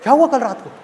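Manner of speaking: loud, hammed-up alarm; mock-panicked shouted question; alarmed father. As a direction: burst out as a worried dad demanding an answer. Father alarmed by daughter's hint.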